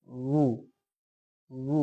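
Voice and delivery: উ formed with rounded lips only; waw with pesh. Shown as a demonstration. Teacher shows waw with pesh from the lips. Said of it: "পেশ দিয়ে".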